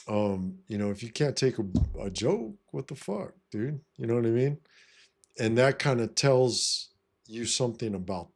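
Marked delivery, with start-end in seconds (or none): none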